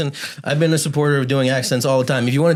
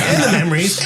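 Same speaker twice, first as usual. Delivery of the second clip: laughing mock tribute; 4 semitones higher than usual. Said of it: "repeated for effect".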